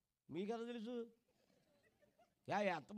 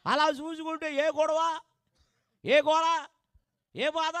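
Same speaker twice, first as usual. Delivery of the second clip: loud, raised preacher's call; full-force preaching; 6 semitones higher, 6 dB louder, slower than usual. Acted. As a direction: drive the sermon home to the congregation. (గట్టిగా ప్రసంగం)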